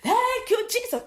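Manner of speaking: high, slow ecstatic praise; praising mother. Retold or imitated. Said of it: "her mum was there going".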